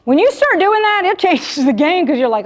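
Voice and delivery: ordinary delivery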